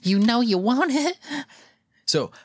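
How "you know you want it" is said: high, slow sleazy creature voice; leering cartoon raccoon; creepy raccoon voice. Voicing the raccoon.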